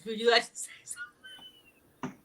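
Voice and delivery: hushed whisper, slow. Real, not acted. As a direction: lean in and whisper it. Whispering an aside to Trey.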